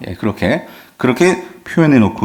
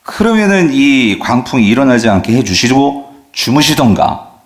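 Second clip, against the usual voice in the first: ordinary talk, nothing marked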